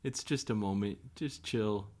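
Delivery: soft, slow laid-back voice; soft, quiet hippie calm; mellow. Retold or imitated. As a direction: soothe him gently. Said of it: "that's like".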